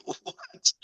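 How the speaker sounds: high, breathless mock disbelief; a squeaked, airy what of disbelief; stunned what. Seeing kids with his photo, high stunned what.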